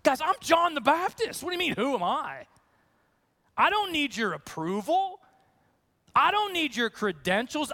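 lightly voiced defiant prophet; indignant John. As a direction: play John brushing off the officials. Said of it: "you almost expect the response to be".

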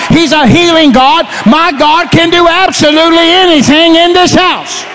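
full-force Pentecostal sermon delivery; fervent preaching. Real, not acted. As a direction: shout the promise out to the whole congregation.

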